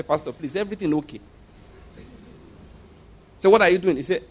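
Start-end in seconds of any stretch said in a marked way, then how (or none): 0.0-1.2 hushed concern. Retold or imitated. Voicing a concerned church member.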